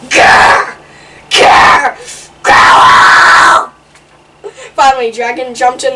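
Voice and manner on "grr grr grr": rasping growl building to a loud roar; louder and louder growl. Pretend. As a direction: let the growl grow louder each time. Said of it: "The growling got louder and louder".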